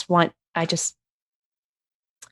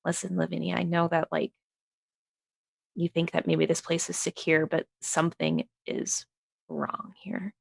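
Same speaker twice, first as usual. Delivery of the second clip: softer, hushed and earnest; quiet private warning; 9 dB quieter than usual. Pretend. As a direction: draw her aside and warn her quietly.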